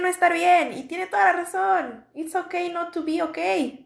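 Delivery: Mexican-accented English, said high and bright. Real, not acted. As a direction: sing out the English line proudly in a Mexican accent. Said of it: "Perdón por mi inglés, lo pronuncio todo chueco".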